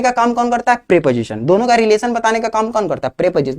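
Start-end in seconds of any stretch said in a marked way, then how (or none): none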